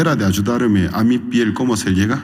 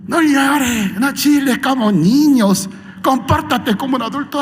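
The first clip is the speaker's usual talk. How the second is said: high, loud mock scolding; a wife scolding her whining husband; 13 semitones higher, 5 dB louder than usual. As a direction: tell him off like a child.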